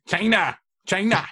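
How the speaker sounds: mock Trump. Trump-style China joke.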